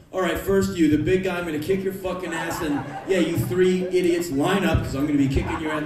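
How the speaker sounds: loud hammed-up brawler challenge; mock tough-guy threat. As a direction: bluster like an action hero. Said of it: "what am I gonna go".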